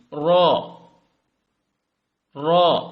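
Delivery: را said thick and full, loud; heavy ra. Shown as a demonstration. Teacher showing the thick را to learners. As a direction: make را heavy and round. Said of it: "انہیں آپ موٹا کریں گے".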